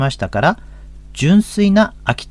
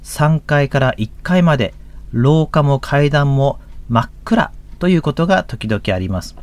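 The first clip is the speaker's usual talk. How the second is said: normal talking voice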